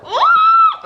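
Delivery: high, loud, laughing shriek of joy; letting it all out; joyful scream. Real, not acted. Thanking Gavin for the gift, shrieking with joy.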